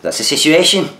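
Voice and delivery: high mock Irish catchphrase; Keith Lemon's Irish accent. Host jokingly denying he does accents, in mock Irish accent. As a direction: slip into a hammed-up, high-pitched Irish call. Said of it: "you won't be getting any Irish accents off me".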